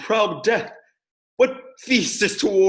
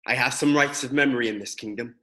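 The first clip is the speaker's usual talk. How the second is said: ordinary talk, nothing marked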